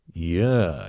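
drawn-out, self-satisfied yeah; smug self-seduction. A narrator's reading. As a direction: preen at your own reflection. Preening at his mirror reflection.

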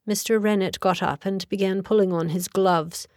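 usual speaking voice

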